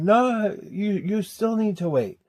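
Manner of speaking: high, slow insistent mother; mom voice. Retold or imitated. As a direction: imitate mom saying wait.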